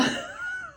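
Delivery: real high, soft laugh; laughing at oneself. Real, not acted. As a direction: break off into a helpless little laugh. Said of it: "I have to laugh at myself".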